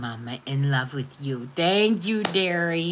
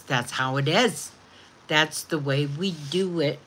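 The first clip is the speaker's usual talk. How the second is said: ordinary delivery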